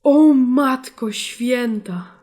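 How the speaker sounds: very slow, drawn-out gasp of disbelief; stunned exclamation. Real, not acted. Player sees the upside-down burning land, stunned. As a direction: let the words stretch in awe.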